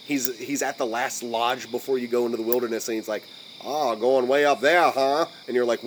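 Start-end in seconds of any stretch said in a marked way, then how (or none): 3.6-5.2 put-on slow old-timer Maine accent; creepy Maine accent. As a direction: draw it out like a creepy Maine local. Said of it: "the creepy Maine accent".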